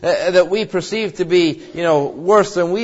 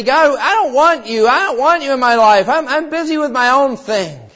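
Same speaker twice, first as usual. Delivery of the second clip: higher, insistent rejection of God; defiant rebel; 7 semitones higher than usual. Retold or imitated. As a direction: push God away, stubborn and defiant. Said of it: "and sometimes say".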